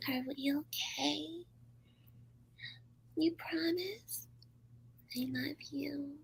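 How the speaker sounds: put-on tiny, whispered high baby voice. Host mimics her pleading with her boyfriend, whispered baby voice. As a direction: coo it slowly in a high, breathy whisper. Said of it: "really B B voice".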